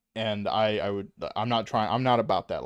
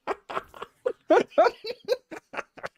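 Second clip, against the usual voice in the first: burst of laughter; 6 dB louder than usual. Real, not acted. Laughs at own mistake.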